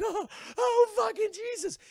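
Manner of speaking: high, loud panicked yelp; mock panic. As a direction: yelp in terrified disgust. Governor panicking.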